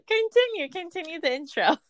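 high-pitched laughing fit; hysterical laugh. Real, not acted. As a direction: laugh hard through the words. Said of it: "Why are you laughing so hard?".

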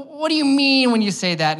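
high-pitched mock protest, falling away; challenging debater. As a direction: push back like a debater stalling. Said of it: "when two people are arguing or they're in a debate".

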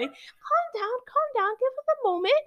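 high fast mocking nurse voice; sarcastic nurse imitation. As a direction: parody the brushing-off nurses, quick and high. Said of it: "and they're like".

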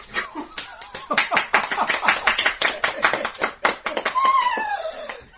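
high, loud helpless laugh; howling laughter. Real, not acted. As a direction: burst into shrieking laughter. Table erupts at pun.

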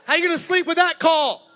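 hammed-up sideline rant; yelling at the ref. Wife yelling at referee at daughter's game, hammed-up yell. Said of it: "she's like".

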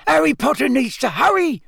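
high squeaky elf voice; squeaky house-elf. A narrator's reading. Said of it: "squeaked Dobby".

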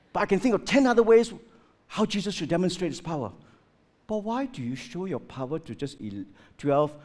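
normal talking voice

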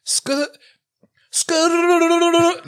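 failed rolled r on "skur", high; trying to trill the r in "skur"; rolled r attempt. Shown as a demonstration. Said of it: "I can't do the R rolling thing".

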